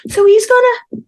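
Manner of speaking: put-on high, loud little girl voice; child voice. Little girl answering her mum in a high, loud voice. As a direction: pipe up like a cheeky toddler.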